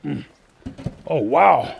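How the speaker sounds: straining under a heavy load; effortful, lifting something heavy; carrying heavy load. Real, not acted. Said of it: "this is heavy".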